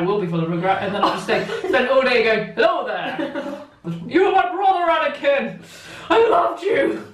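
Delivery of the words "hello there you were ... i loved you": high, anguished mock-heroic cries; hammed-up film hero. Spending all day quoting to Anakin, in a hammed-up anguished voice. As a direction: declaim it to a fallen brother, overacting.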